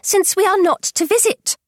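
high, resentful mother's voice. A narrator's reading. Mother sulking that the family cannot visit, high and resentful. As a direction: sulk it out in a high complaining pitch. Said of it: "said her mother resentfully".

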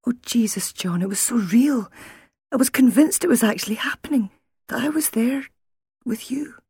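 high-pitched, distressed insistence; shaken. A narrator's reading. Wife tells husband the nightmare felt real, shaken. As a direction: pour it out, still caught in the dream.

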